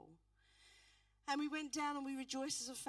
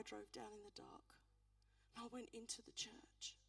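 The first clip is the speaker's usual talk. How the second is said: whisper; 10 dB quieter than usual. Real, not acted. Going into the dark church alone.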